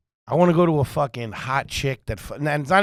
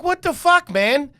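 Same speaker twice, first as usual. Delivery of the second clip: high, louder shocked outburst; much higher, louder what the fuck; retold exclamation; 13 semitones higher, 4 dB louder, slower than usual. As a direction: blurt it out in shock. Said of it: "I'm like".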